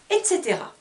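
usual speaking voice

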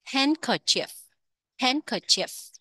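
"Handkerchief" starting high on HAND, falling after; first-syllable stress. Shown as a demonstration. Teacher repeating it for learners, stress on HAND in "Handkerchief". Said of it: "nhấn âm một các bạn".

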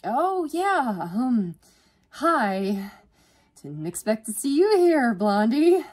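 loud, high, halting greeting; flustered. A narrator's reading. Student greeting her crush, flustered. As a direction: stumble into it, then overdo the volume.

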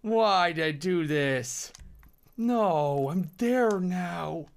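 high, drawn-out wail of regret; loud lament. Real, not acted. Gamer regretting what he did, loud drawn-out wail. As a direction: wail it slowly, stretching each word.

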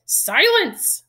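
high, loud explosive command; yelling. A narrator's reading. Uncle silences the boy, yelling. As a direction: yell to cut off all argument. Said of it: "yelled Uncle Vernon".

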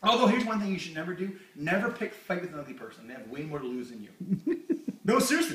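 ordinary delivery

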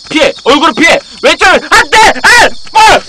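loud, high panicked shouting; frantic yell. Real, not acted. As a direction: yell it as the car crashes. Frantic steering in a game.

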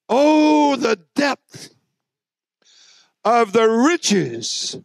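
slow, drawn-out preacher intoning scripture; high, slow pulpit chant of a Bible verse; scripture recital. Acted. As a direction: lift each phrase high and stretch it out like a psalm.

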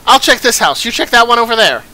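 loud, high alarmed villager voice; urgent calling out. Acted. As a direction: shout for help over a town fire. Villager calling out in burning town.

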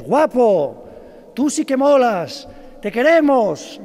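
loud, high, slow cheering call; mock cheering. The preacher imagines the crowd cheering Jesus, loud and high. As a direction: hail him like an adoring crowd.

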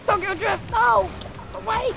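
high, excited game shouting; urgent yelling. Real, not acted. Player to others on the field, urgent high yelling. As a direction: yell in alarm as the play goes wrong. Calling out during tense play.

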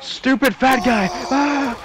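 real annoyance, shouting at the enemy; frustrated yell. Real, not acted. Getting beaten up in game.

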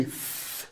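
"f" as a long hissed TH through the teeth; voiceless TH sound. Shown as a demonstration. Teacher showing one TH sound, "f" as sustained voiceless TH. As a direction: hold "f" as TH, tongue between the teeth. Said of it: "Ela pode ter o som de".

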